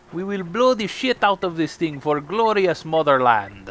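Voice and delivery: put-on Russian accent that slides toward pirate; mock Russian accent with pirate flavor; bad Russian accent. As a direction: ham up a patriotic Russian accent. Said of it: "That was like a half pirate".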